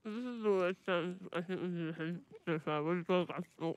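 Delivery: garbled through a mouthful of biscuit; mumbling with a full mouth. Real, not acted. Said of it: "I don't know what you just said".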